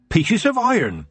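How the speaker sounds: put-on high-pitched old witch voice; Nanny Ogg. A narrator's reading. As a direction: instruct the other witches in a high voice.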